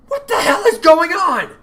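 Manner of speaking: a bewildered leader yelling at the chaos; exasperated demand. Acted. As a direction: bellow your confusion. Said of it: "Oliver demanded".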